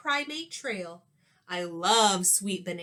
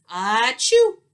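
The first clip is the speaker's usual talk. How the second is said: very loud sneezing achoo; big sneeze; 12 dB louder than usual. Acted. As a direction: let a huge sneeze burst out. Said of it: "she let out a sneeze".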